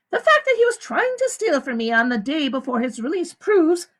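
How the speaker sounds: a high, smooth woman's voice; the warden. A narrator's reading. The warden explains to the visitors, high and smooth. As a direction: lie calmly to the visitors.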